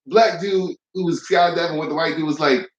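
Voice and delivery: ordinary delivery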